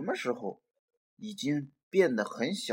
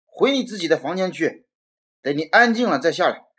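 fast, loud stern order; angry dad; 3 semitones higher, 7 dB louder, faster than usual. Acted. Dad orders Robert to his room, fast angry scolding. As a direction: send him off firmly and quickly. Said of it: "爸爸生气地说".

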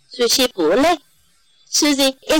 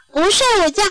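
put-on high-pitched child's no; little girl refusing; 7 semitones higher, much faster than usual. A narrator's reading. Susie telling Mom no nap, high-pitched refusal.

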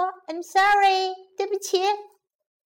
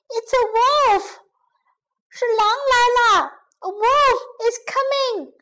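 high, urgent calls for help; panicked shouting; 8 semitones higher, 5 dB louder than usual. A narrator's reading. Shepherd boy fakes another wolf alarm to villagers, shouting in panic. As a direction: shout it high to bring everyone running. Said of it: "他又大喊起来".

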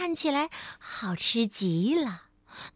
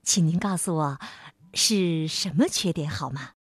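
lower, courteous asking; Mr. Perfect asks politely, voice lowered; polite request; 7 semitones lower than usual. A narrator's reading. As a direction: ask it gently and politely. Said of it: "像平时一样有礼貌地问".

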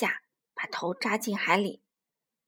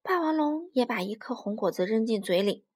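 usual speaking voice

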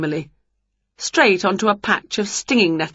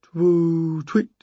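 answering owl. A narrator's reading. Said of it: "came a reply".

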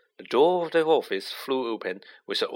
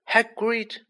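mildly raised, high-pitched call; 11 semitones higher than usual. A narrator's reading. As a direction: cut in firmly on a high pitch. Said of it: "said Dumbledore loudly".

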